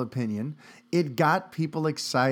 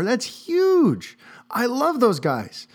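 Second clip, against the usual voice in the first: excited; 8 semitones higher, much slower than usual. Real, not acted. Excited news reaction.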